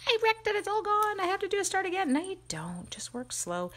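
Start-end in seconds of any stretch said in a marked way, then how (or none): 0.1-2.1 high, whiny mock panic; panicked viewer. Mimicking crafters who think they ruined the tag, high mock panic. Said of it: "they're like".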